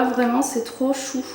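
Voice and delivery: usual speaking voice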